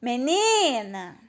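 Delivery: "Menina" called slowly, stretching and lifting "ni"; drawn-out call of "Menina", swelling on the middle syllable; calling at the gate. Shown as a demonstration. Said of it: "Vamos chamar no portão".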